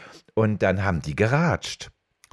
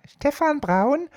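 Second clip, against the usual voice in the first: put-on much higher, slow child's voice; much slower than usual. Boy answering the home phone, high child voice. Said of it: "so eine hohe Stimme als Kind".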